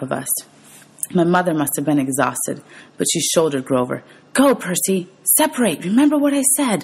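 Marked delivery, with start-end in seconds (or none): 4.3-6.8 raised, higher urgent shout to her son; a mother's urgent high cry telling her son to go; urgent call. Acted. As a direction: push him to run, voice lifted high. Mother urges son to flee.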